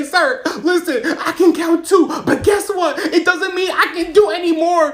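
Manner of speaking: high-pitched, loud pleading argument; exasperated protest. Retold or imitated. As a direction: plead your case loudly, voice climbing. Arguing back at the drill officer.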